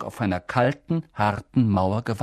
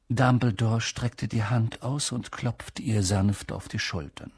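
plain reading voice

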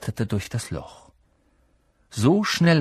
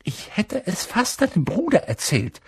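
much higher, pointed schoolgirl voice; sharp little girl voice; 9 semitones higher than usual. A narrator's reading. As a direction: needle him with it. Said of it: "sagte Hermine spitz".

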